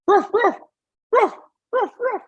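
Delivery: high yappy barking imitation; imitated dog barks. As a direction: yap like a small dog, very high. Dog barking heard in the woods.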